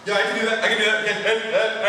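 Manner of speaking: ordinary delivery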